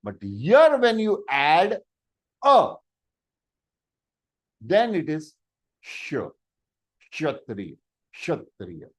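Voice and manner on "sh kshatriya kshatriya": kshatriya said low and soft, shətriə; sounding kshatriya. Shown as a demonstration. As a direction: model the ksh sound. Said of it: "Now you can pronounce".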